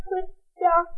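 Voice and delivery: ordinary delivery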